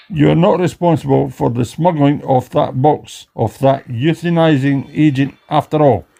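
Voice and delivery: ordinary talk, nothing marked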